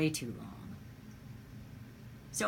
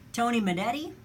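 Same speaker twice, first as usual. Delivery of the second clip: ordinary delivery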